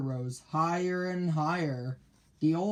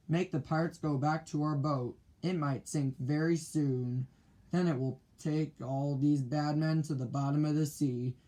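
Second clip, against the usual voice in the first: ordinary delivery, nothing marked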